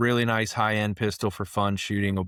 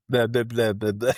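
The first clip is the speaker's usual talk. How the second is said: mock tongue-tied babble, a little raised; 4 semitones higher, 3 dB louder than usual. Caller caught out by the company president, tongue-tied. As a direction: imitate someone who literally can't get words out. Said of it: "He literally couldn't talk".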